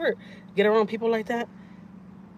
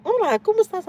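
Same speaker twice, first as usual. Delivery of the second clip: much higher, sing-song hello; mock sweet greeting; 5 dB louder than usual. Chatty lady in the waiting room greets her sweetly. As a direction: greet like a syrupy chatty stranger.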